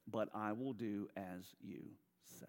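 softly surrendering. Shown as a demonstration. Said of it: "Key phrase, this is faith".

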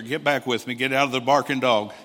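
ordinary delivery

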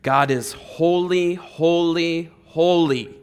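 loud, slow, ringing proclamation; proclaiming seraphim. Retold or imitated. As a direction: cry it out like angels before the throne. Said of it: "they said".

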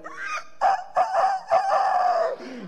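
high, loud cackling laugh; barnyard cackle. Retold or imitated. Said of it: "you talk about a barnyard banny. I went".

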